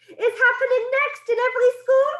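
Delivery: high, loud teasing shout; shouting witch accent. Acted. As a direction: rouse the crowd. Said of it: "shouted the Grand High Witch".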